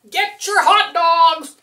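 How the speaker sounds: loud, high hot dog seller calling out; vendor call. Acted. Host calls out like a hot dog vendor in the story. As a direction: hawk the hot dogs like a vendor.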